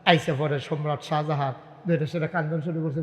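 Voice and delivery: usual speaking voice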